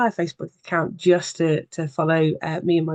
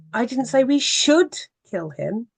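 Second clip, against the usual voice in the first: "should" lifted higher in a changed voice, rising at the end; stress on SHOULD; 6 semitones higher than usual. Shown as a demonstration. Showing the webinar audience the power of the voice: stress on "should". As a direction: lean hard on "should", as if only suggesting it. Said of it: "you can put a stress on each of those words".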